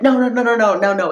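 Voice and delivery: high, loud, rapid string of protest; mock objection. People disputing her terror idea, high loud mock protest. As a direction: imitate people jumping in to disagree.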